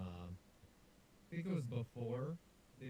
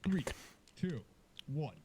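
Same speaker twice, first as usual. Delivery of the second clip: lightly voiced fight announcer countdown; 2 semitones higher, slower than usual. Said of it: "let me do a voice".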